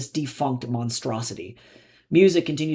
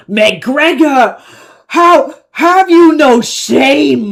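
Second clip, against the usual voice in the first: high, very loud, slow scream; 12 semitones higher, 14 dB louder, much slower than usual. Acted. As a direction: scream it in wounded outrage. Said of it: "he screamed at the silent figures".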